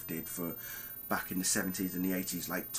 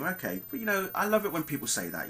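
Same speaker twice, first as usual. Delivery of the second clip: high-pitched sarcastic delight; 9 semitones higher than usual. Real, not acted. Reviewer mocks viewers who claim they know endings, sarcastic.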